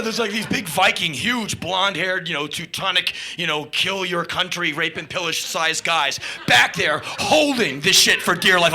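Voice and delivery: ordinary talk, nothing marked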